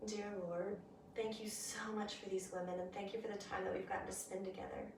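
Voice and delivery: soft, reverent closing prayer. Acted. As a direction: pray quietly and sincerely. Closing prayer with bowed heads.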